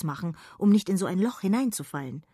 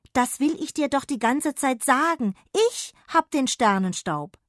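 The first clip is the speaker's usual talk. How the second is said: higher, louder exasperated call; calling back; 8 semitones higher, 6 dB louder than usual. Acted. Jonna calls back to Sausewind, exasperated and loud. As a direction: finally get it said. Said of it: "rief Jonna zurück".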